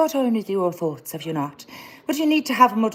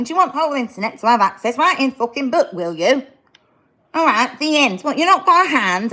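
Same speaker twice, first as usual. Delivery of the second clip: higher, louder teacher scolding the class; stern teacher; 6 semitones higher, 5 dB louder than usual. Acted. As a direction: boss the class around to wrap up the lesson. Plays a teacher ending a lesson.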